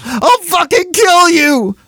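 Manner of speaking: very high, loud, drawn-out howl; howled scream. A narrator's reading. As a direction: scream the threat in raw rage. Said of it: "Davy howled, screaming".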